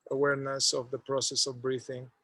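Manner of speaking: ordinary delivery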